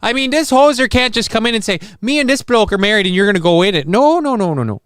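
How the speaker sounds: high, hammed-up Minnesotan protest; Minnesotan accent. Wedding officiant objecting to the couple, mock Minnesotan accent. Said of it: "a little bit of Minnesotan".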